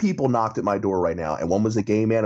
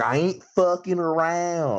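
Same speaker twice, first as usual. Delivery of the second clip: put-on slow, higher drawled hillbilly Ozark accent; Ruth's Ozark accent; 7 semitones higher, slower than usual. Imitating his girlfriend's Ruth from Ozark impression, slow Ozark accent. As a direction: lean into the Ozark twang, slow and raised. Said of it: "She nails it".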